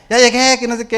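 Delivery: high, loud cheerful shout; shouting arrival. Retold or imitated. Son bursting in from school, loud high shout. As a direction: announce yourself loudly coming home. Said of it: "empieza a gritar".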